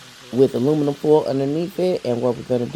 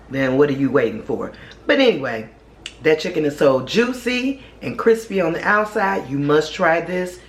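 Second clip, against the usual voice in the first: normal talking voice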